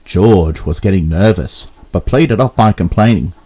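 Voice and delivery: plain reading voice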